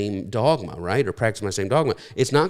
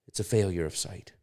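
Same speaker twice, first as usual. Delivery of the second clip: softer, lowered voice after a long pause; hushed repeat; 3 semitones lower, 6 dB quieter than usual. Real, not acted. Preacher letting the key point settle, low and quiet. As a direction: let "It's a failure of sight" sink in quietly.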